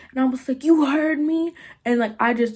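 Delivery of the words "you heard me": high, defiant comeback; mock defiant yell at normal volume; retold screaming back. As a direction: throw it back at her, pitched up. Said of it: "kept screaming at her".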